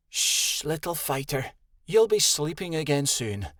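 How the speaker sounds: hushed shh, gentle to a pup; calm a fussing baby; soothing. Acted.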